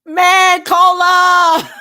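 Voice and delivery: loud, high laughing call of a name; excited shout. Real, not acted. Streamer greeting a viewer, loud laughing shout. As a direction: burst out calling his name with a laugh.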